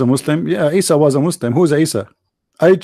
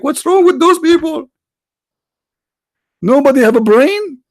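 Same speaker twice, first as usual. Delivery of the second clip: exasperated outburst; 10 semitones higher than usual. Real, not acted. Exasperated at the chat room.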